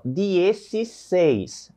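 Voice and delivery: dezesseis said slowly, each syllable drawn out; slow clear pronunciation. Shown as a demonstration. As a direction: stretch out dezesseis clearly for students. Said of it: "Olha como muda um pouco a entona".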